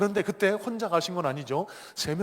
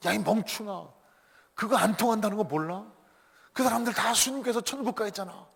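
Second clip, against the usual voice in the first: higher-pitched exasperated scolding; acted anger; 6 semitones higher than usual. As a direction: scold a bungling underling. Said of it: "이 사단이 막 화를 내는 거예요".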